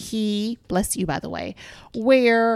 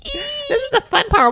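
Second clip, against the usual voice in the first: much higher, playful repeat; a high playful echo of her own line; silly high voice. Acted. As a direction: squeak it out for fun.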